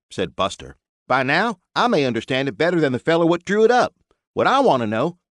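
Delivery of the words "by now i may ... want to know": folksy builder. Acted. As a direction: talk like a blunt country mechanic. Buster's drawl in dialogue.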